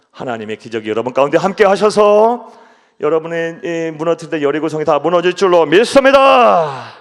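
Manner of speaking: loud proclaiming build to 믿습니다; fervent proclamation. Real, not acted. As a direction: declare it loudly to the church. Preacher proclaims faith climax. (큰 소리로 선포하듯)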